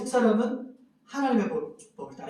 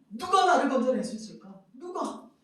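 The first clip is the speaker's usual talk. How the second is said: raised, loud pleading cry; anguished plea; 6 semitones higher, 4 dB louder than usual. Acted. Paul asking who can save him, a loud anguished plea. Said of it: "이렇게 말하거든요".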